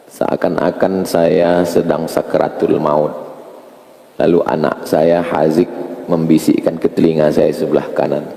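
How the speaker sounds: walk them gently through their own burial; guided contemplation. Acted. Leads a death contemplation.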